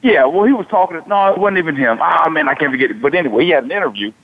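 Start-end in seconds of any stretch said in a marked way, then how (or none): none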